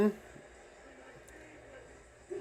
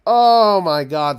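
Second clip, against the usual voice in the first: louder and slow, dragged-out moan; groaning despair; 2 semitones higher, 9 dB louder, slower than usual. Real, not acted. Fan groans at the defense's mistakes, despairing. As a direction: moan it like you can't watch anymore.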